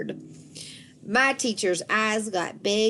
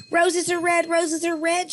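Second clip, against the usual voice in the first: higher, eager call; 9 semitones higher than usual. A narrator's reading. Grace calling out to the teacher, eager shout. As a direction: call it out to be picked. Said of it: "she called out".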